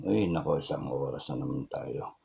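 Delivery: ordinary talk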